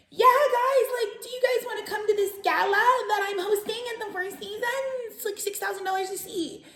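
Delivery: mock fancy self. To her family, inviting them to a gala in a high mock-posh voice. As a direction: ham up a posh hostess invitation. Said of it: "translating myself to my family".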